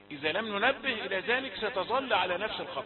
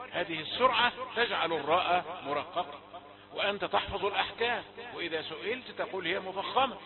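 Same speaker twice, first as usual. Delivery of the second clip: normal talking voice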